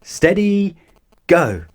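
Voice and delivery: high, loud race start call; starter's call. A narrator's reading. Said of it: "called the starter".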